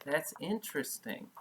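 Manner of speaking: usual speaking voice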